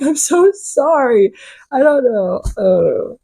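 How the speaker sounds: flustered, drawn-out mock apology; awkward, embarrassed sorry. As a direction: apologize like you want the floor to swallow you.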